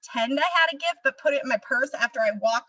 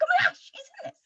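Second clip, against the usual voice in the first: much higher-pitched gasp of discovery; surprised recognition; much faster than usual. Acted. Viewer spots the actor mid-film, surprised recognition. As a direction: squeal it like a viewer spotting a favourite actor.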